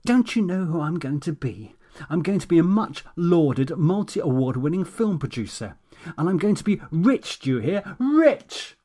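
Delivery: hammed-up tantrum of grandeur; imagined scream. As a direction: rage at him, peaking on rich. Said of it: "scream in his ear".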